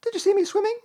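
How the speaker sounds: very high excited squeak; Roo. A narrator's reading. Little one climbing out after swimming, squeaking excitedly. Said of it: "squeaked Roo excitedly".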